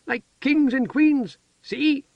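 put-on high, slow childlike voice; proud as a child showing off; excited simple-minded woman. A narrator's reading. Heather shows her feather crown.

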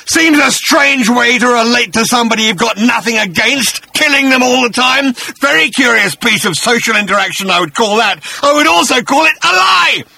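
high, loud, sarcastic monster voice rising to a shout; a beast calling out a lie; accusing bat-creature. Acted. Agrajag accuses Arthur.

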